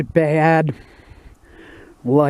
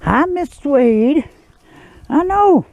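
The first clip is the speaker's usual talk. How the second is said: high dog-talk voice; 12 semitones higher, slower than usual. Real, not acted. Owner greeting her sulking dog, high pet voice. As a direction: coo it up high like talking to a pet.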